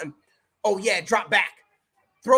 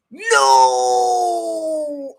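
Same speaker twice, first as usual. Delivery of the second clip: dismayed shout; 9 semitones lower, 6 dB louder than usual. Real, not acted. Player he started gets hurt.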